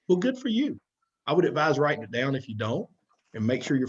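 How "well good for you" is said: high, teasing "good for you"; a needling pat on the back; sarcastic praise. Acted. Coach mocks listeners who claim readiness.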